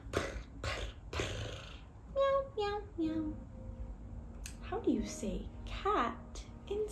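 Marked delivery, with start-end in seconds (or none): 0.1-1.2 quiet rolled cat purring. Acted. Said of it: "Cats say meow". 2.1-3.3 short cat meows in a row. Acted. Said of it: "Cats say meow".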